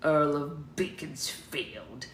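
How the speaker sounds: slow, drawn-out mimicking of a rival; mocking mimicry. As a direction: mimic his pompous title, dragging it out. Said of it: "he mimicked".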